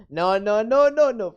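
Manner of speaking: loud, rising string of noes; panicked protest. Real, not acted. Begs Kisa not to clip.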